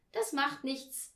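plain reading voice

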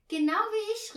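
loud, high exclamation; excited cry. A narrator's reading. Tina finding the daughter shares her age, excited cry. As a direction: cry out, pitch shooting up. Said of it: "rief Tina".

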